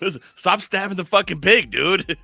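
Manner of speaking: mock shout. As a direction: scold a friend for stabbing the pig, half laughing. Acted scolding in the story.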